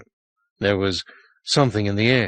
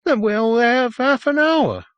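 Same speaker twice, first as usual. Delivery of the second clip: put-on higher, louder, urgent child; anxious boy; 4 dB louder than usual. A narrator's reading. Boy warns the sergeant about the time, higher and urgent. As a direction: count down the time with dread.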